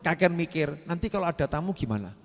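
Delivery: ordinary talk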